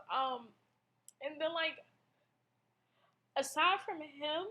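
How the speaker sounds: ordinary talk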